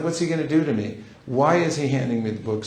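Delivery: usual speaking voice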